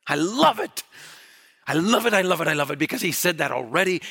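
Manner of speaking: high, loud, thrilled repetition; excited delight. Real, not acted. Preacher delighting in Peter's words to the congregation, loud and high. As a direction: burst out with joy over the text.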